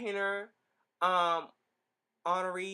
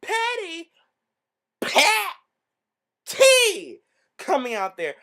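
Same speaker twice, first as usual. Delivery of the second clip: excited shout; 11 semitones higher, 11 dB louder, slower than usual. Retold or imitated. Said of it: "I'm like".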